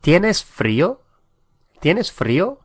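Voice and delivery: "¿Tienes frío?" with a high rise on tienes and a rising end; asking a question. Shown as a demonstration. Teacher models a Spanish question for students, rising tune. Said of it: "How would you ask the question".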